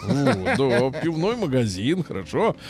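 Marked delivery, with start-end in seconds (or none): none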